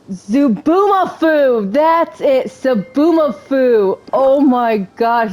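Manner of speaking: drawn-out, delighted exclamation; thrilled to finally remember it; excited recognition. Real, not acted. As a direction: stretch out the name as it comes back to you. Excited at recalling show name.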